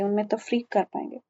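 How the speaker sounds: usual speaking voice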